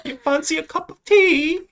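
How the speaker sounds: high-pitched British caricature; hammed-up British impression; mock British accent. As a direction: pipe it up in exaggerated British. More mock British phrases.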